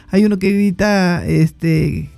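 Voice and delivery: usual speaking voice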